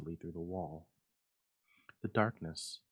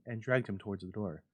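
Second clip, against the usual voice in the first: ordinary delivery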